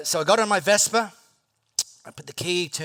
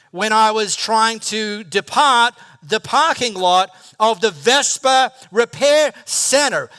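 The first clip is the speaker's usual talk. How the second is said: slow, loud, raised rant to himself; exasperated self-talk; 5 semitones higher, 10 dB louder, slower than usual. Retold or imitated. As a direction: drag it out in frustration. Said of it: "So I think to myself".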